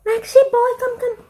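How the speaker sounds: calling the dog with a high coaxing call. Real, not acted. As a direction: sing out to coax him over.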